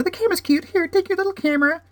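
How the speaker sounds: put-on very high, sweet baby-talk voice; a sing-song cutesy voice handing over a little camera. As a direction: coo it like talking to a toy. Handing toy accessory, cutesy voice.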